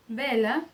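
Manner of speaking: vela with a Spanish B sound, "bela". Shown as a demonstration. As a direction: start vela on a B, lips together. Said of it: "ele vai ser pronunciado como B". (V com som de B em vela)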